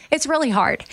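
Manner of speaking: real tears close to the surface; emotional. Real, not acted. Telling listeners about a hard time, voice catching. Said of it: "I don't even know why I'm getting emotional".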